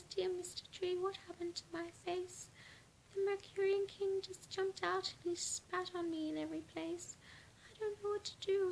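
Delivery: high, pleading little voice; tearful girl's voice. A narrator's reading. The girl pleads with the tree, high and tearful. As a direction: sob it out to the tree, high and small. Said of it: "and she says".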